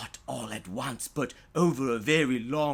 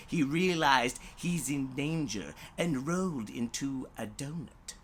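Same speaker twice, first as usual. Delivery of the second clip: ordinary delivery, nothing marked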